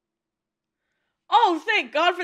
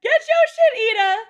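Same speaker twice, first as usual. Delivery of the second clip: higher, building cheer; hyped urging; 5 semitones higher, 5 dB louder, faster than usual. Real, not acted. Viewer cheering on Ida to get it together, hyped urging. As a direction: urge them on, pitch climbing with each repeat. Cheering the character on during show.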